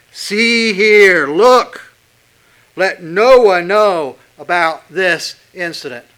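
loud, slow, emphatic preacher warning; stern emphatic warning. Acted. Preacher to the congregation, voicing Jesus in a loud stern warning. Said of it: "very emphatic here".

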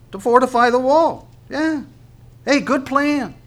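high-pitched, exaggerated approval; mock enthusiasm. Mocks the people's wall plan.

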